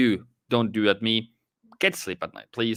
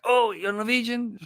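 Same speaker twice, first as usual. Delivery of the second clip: much higher, delighted mock gasp at meeting a countryman; excited surprise; 11 semitones higher than usual. Homesick Norwegian abroad meeting a countryman, delighted and much higher. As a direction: play the homesick expat thrilled to hear his own language. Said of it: "You're like".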